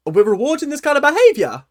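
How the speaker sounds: high, loud incredulous question; hammed-up indignation, pitched right up; mock outraged disbelief. Fuming at bad behaviour being rewarded, incredulous.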